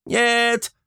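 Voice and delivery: high panicked cry; terrified wail. A narrator's reading. Flappy howls at the monster, terrified. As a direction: howl no in terror. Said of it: "завопил Флаппи".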